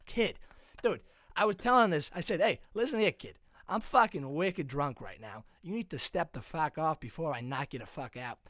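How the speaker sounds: put-on brash drunk-bro Boston; Massachusetts accent. Drunk masshole recounting seltzers and a fight, brash Boston accent. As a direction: tell the bar fight in broad Boston swagger. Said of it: "I just mastered the guy's accent".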